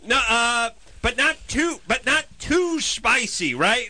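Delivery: high, halting mock plea; insistent customer. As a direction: plead to be understood by the clerk. Customer insisting on mild order.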